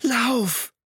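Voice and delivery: lauter, hoher Fluchtschrei; Schrei. A narrator's reading. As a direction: schrei ihn an, dass er rennt. Hester befiehlt Tom zu fliehen. (Hester schreit Tom zu, lauter hoher Schrei)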